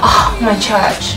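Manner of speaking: normal talking voice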